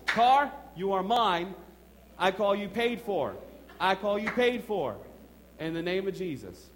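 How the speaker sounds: slow, loud declaration with each phrase hammered; booming slow faith declaration; loud proclaiming. Retold or imitated. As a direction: proclaim it to the car like a preacher. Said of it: "Some of you are laughing, but I did this".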